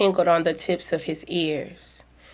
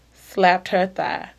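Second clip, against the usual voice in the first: usual speaking voice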